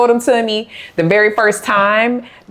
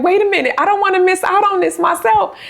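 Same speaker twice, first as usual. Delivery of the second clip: excited; 12 semitones higher, faster than usual. Retold or imitated. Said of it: "I was like".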